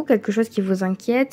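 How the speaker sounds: ordinary talk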